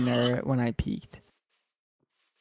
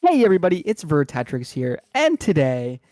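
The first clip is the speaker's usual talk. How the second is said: put-on bright, loud kid-YouTuber greeting; YouTuber intro voice; 3 semitones higher, 5 dB louder than usual. Shown as a demonstration. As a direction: open the video like a hyped YouTuber. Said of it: "Hit me with the old intro".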